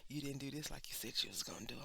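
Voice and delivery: low, fast whispered scolding; scolding auntie. Retold or imitated. A friend who cusses her out, hushed scolding. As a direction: tell her off in a hushed rush. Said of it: "she's like".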